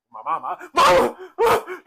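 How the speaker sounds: loud, high hammed-up wail for mama; exaggerated crying shout, played for laughs; mock crying. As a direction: bawl like a child, breaking into laughter. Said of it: "I just instantly start crying".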